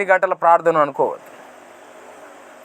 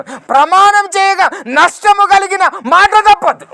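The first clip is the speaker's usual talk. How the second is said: loud, high, ringing proclamation of scripture; preaching at full force; 12 semitones higher, 6 dB louder, slower than usual. Acted. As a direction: cry the verse out to the congregation. (గట్టిగా ప్రసంగ స్వరం)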